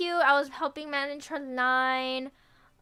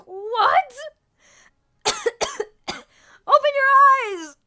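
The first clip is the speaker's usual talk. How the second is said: loud, high strained cry; outraged disbelief; 10 semitones higher, 10 dB louder, slower than usual. Retold or imitated. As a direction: yell at them to wake up.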